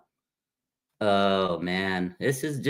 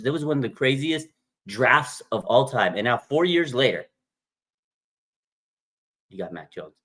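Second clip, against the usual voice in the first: ordinary talk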